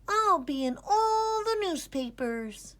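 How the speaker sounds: put-on high little boy voice; dreamy boast. A narrator's reading. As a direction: boast about the fame to come. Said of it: "he said".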